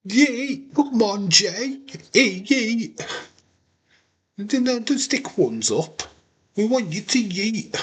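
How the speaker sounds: high, pleading cheer for the yeet; urging yeet. Real, not acted. Fan begging Jay to yeet, high and eager. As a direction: egg him on like a fan.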